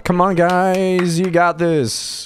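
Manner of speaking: high, loud, slow hype-up; mock cheer. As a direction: cheer the squad on like a coach. Cheering on the game squad.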